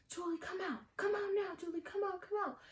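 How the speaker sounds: high taunting call to come outside; sing-song call from the doorstep. Retold or imitated. Friend calling daughter out.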